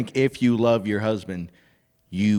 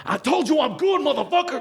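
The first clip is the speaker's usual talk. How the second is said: guy voice; 14 semitones higher than usual. Retold or imitated. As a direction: switch to a tough dude snapping. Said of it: "change her voice to, like, a guy voice".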